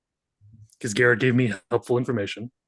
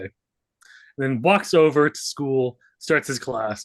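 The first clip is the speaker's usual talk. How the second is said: ordinary delivery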